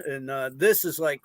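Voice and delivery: usual speaking voice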